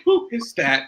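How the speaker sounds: high, laughing retold question; mock astonishment through amusement; amused stepmother. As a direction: imitate a tickled stepmother, pitched high. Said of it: "it always made my stepmother laugh".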